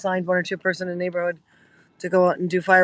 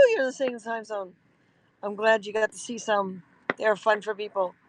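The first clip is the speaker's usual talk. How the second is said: normal talking voice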